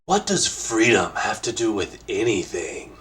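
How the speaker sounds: slow, put-on presenter voice for a question; measured, deliberate voice impression; Tim Rogers. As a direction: pose the question slowly in an imitated voice. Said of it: "I'll do my best Tim Rogers voice".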